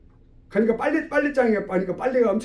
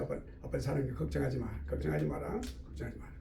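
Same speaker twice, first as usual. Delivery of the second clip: very soft, low fatherly murmur; tender reassurance; 7 semitones lower, 13 dB quieter than usual. Acted. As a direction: soothe the child, fading almost to nothing. Father comforting his crying child. (아주 작고 다정하게 안심시키듯)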